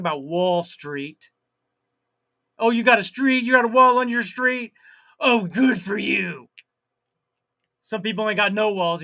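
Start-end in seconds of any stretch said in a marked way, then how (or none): 2.6-6.5 sarcastic mock praise. Mocking Wall Street people.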